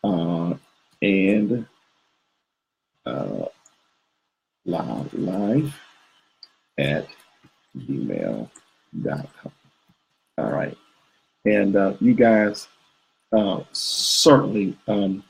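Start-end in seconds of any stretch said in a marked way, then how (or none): 0.0-10.8 muttering low and soft while typing; quiet mumbling while typing. Real, not acted. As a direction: drop the voice, mumble as you type. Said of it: "I'll type it again".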